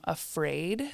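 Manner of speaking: ordinary talk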